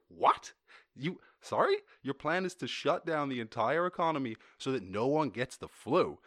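outraged, baffled questioning; incredulous. Acted. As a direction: react as if the plan is absurd. Acted incredulous reaction to proposal.

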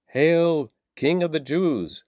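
high-pitched, narrated mock salute; mocking hail. A narrator's reading. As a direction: hail him in mockery, voice lifted high. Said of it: "they mocked".

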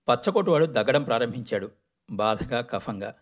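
usual speaking voice

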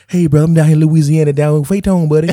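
loud, put-on Louisiana accent; Steven Seagal. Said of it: "trying to talk like he was like".

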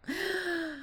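quiet startled gasp; soft gasp. Real, not acted. Host gasps at the noise outside. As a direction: catch a breath in surprise.

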